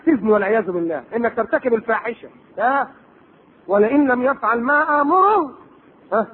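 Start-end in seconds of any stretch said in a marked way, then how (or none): 3.7-5.5 slow drawn-out verse; Quranic recitation. Acted. Preacher recites the wife of al-Aziz's threat, slowly chanted.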